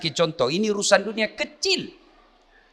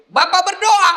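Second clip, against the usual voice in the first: preaching; 13 semitones higher, 7 dB louder than usual. Acted. Preaching at full force.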